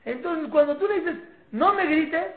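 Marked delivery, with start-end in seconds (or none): 1.5-2.3 high, loud parental order; mock shouted reprimand; acted yelling. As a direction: raise your voice at a child, acted out. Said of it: "cuando tú le gritas".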